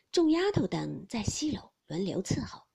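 plain reading voice

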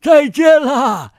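slow, drawn-out loud farewell; loud goodbye. Real, not acted. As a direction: call out goodbye slowly. Host calls goodbye to children.

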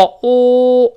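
"o" held long as a rounded "aw", high and loud; long rounded vowel. Shown as a demonstration. Teacher demonstrating the British vowel, long and rounded. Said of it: "发o".

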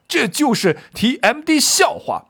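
high-pitched indignant curse; 季布骂人. Acted. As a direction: swear it out with acted outrage. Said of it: "这应该还会加点骂字儿". (高声怒骂，加重脏字)

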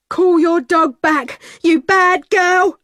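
put-on high, loud, slow yell at the girl; frightened shout. A narrator's reading. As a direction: shout it out in panic. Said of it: "he shouted".

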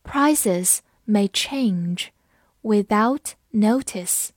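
slow and deliberate, pausing between words; slow read-along. Shown as a demonstration. Teacher reads the sentence for repeating, slow word-by-word delivery. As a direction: stretch out the sentence for learners. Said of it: "跟我慢读一遍这个句子".

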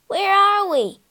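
high, worried whimper; anxious, high-pitched question. A narrator's reading. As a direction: whimper it like a small frightened ghost. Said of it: "the little ghost whimpered".

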